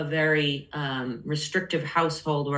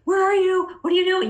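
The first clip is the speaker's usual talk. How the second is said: much higher, louder fretting mother; worried mom; 12 semitones higher, 4 dB louder than usual. Retold or imitated. Mother fretting over her teen daughter, high and anxious. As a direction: imitate an anxious, hovering mom. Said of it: "my mom was super like".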